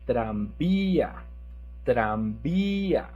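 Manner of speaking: "tranvía" slow, the í stretched and stressed; strong stress on VÍ. Shown as a demonstration. Teacher models the accent, long loud í in "tranvía". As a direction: lean hard on the í of "tranvía". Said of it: "we have to make strong".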